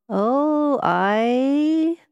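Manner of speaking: high, drawn-out Highland reply; slow, lilting Highlander; slow answer. A narrator's reading. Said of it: "was the slow answer".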